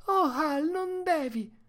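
high, loud pleading woman's cry; Mercedes crying out. A narrator's reading. Woman interceding for the dogs with her brother, high pleading cry. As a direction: beg him to stop in a high wail. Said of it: "intercedette gridando".

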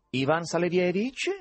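unpleasant nasal caller, pitched high. A narrator's reading. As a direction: ask through the nose, obnoxiously. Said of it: "con un'antipaticissima voce nasale". (voce nasale antipaticissima)

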